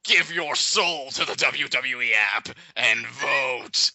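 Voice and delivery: slow, put-on ominous voice; mock sinister promo. Spoofing the voting app plug, mock sinister voice. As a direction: intone it slowly like an evil command.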